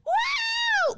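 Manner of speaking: very high, whooping crowd cheer; mock audience woo. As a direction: hoot like a clueless fan. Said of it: "people are like".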